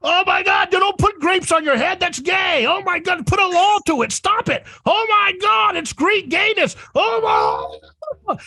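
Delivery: high-pitched mock outrage; screaming overreaction. A conservative reacting to grapes on heads, mock screaming. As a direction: act out a panicked, scolding freak-out. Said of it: "Instead of screaming and reacting".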